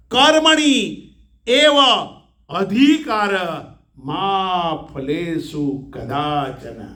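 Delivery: slow, drawn-out chanting of the verse; verse recitation. Acted. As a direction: intone it slowly and reverently. Quotes Gita verse. (ધીમું ગીતા શ્લોક પઠન)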